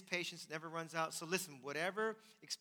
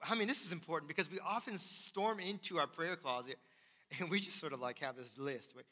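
ordinary talk

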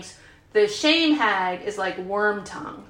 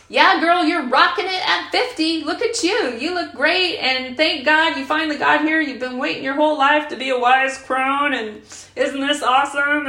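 put-on higher, upbeat cheerleading girlfriend voice; queen of compassion; 4 semitones higher, 3 dB louder than usual. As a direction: gush encouragement like a proud best friend. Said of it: "my queen of compassion is like".

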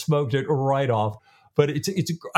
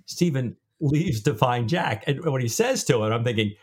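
ordinary talk

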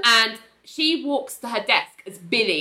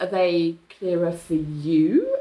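slow, low, mildly wary question; suspicious question; 5 semitones lower, slower than usual. Retold or imitated. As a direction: probe slowly with a touch of doubt. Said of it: "Ali's, like, suspicious".

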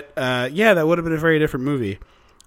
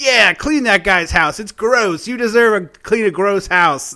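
higher, louder gloating jeer; taunting; 5 semitones higher, 4 dB louder than usual. Retold or imitated. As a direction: gloat over the spoiled rich lady. Mocking the spoiled rich character.

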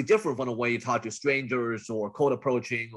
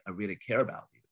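lower, softer caring voice; sympathetic caring; 4 semitones lower, 6 dB quieter, faster than usual. Shown as a demonstration. Coach demonstrating the sympathetic persona, caring and low. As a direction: reassure them gently, voice dropped low. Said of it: "the sympathetic caring one".

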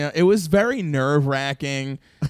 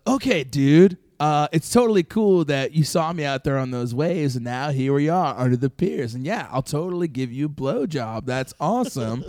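normal talking voice

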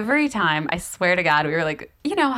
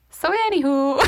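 raised-pitch Wisconsin "so anywho"; imitated Wisconsin accent; 6 semitones higher than usual. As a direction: fill the lull in a mock Wisconsin accent. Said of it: "I'll just be like".

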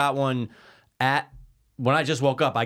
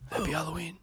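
strained, much softer croak of a greeting; hoarse sore throat voice; 4 semitones higher, 10 dB quieter than usual. Retold or imitated. To the co-host, showing how little voice was left, a soft hoarse croak. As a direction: rasp it out like your voice is shot. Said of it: "I wanted to scream".

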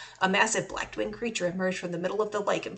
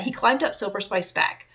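ordinary delivery

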